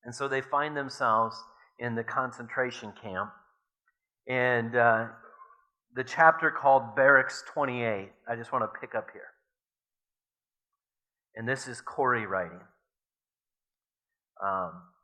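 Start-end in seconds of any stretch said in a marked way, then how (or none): none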